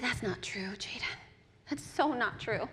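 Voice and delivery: quiet motherly comfort; tender reassurance. Acted. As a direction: gently reassure a hurt child. Mother reassuring her sad son.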